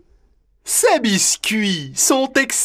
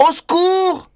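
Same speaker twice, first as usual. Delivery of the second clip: higher panicked cry; calling for help; 7 semitones higher than usual. Acted. Mowgli drowning.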